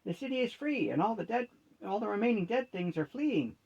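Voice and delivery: high, bright burst of excitement; enthused. A narrator's reading. Gnome cheering Wendy after the vampire falls, excited. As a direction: let the win spill out eagerly. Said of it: "a gnome enthused".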